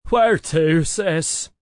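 loud call from the wheel; yelled question. A narrator's reading. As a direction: call it out to your sister. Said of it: "Neil yelled from the steering wheel".

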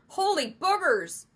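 high, loud yell of alarm; shocked, high-pitched exclamation. A narrator's reading. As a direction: yell into the comm. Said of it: "Sam yelled into his comm".

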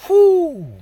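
high, loud whoop of fervour; excited whoo. Real, not acted. Preacher reacting to the scripture he read, a loud high whoop.

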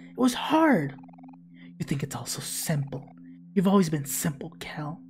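ordinary delivery

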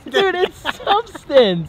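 slow, high, stubborn drawl; drawn-out insistence. Real, not acted. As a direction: stretch it out, refusing to budge. Stubborn repeat in argument.

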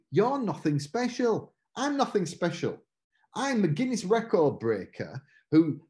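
ordinary delivery

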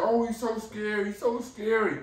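high, mock-frightened imitation of a nervous onlooker; mock scared person. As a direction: ham up someone afraid of the dog. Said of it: "you would look at a big dog, like".

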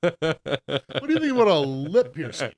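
laughing. Real, not acted. Joking suggestion, laughing.

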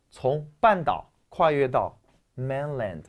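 usual speaking voice

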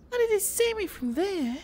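high-pitched, exasperated disbelief; incredulous dismay. Real, not acted. Gamer just shot from an unseen spot, high disbelieving complaint.

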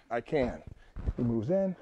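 usual speaking voice

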